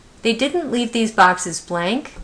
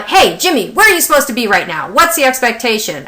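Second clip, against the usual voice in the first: loud, higher, demanding teacher voice; stern teacher tone; 4 semitones higher, 7 dB louder than usual. Retold or imitated. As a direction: call out a pupil like an overbearing teacher. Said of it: "it's this teacher tone".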